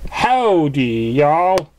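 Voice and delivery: southern "Howdy, y'all" lightly voiced; a touch of a farm-girl southern accent; country drawl greeting. A narrator's reading. Applejack greeting the group in a mild southern accent. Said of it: "said Applejack".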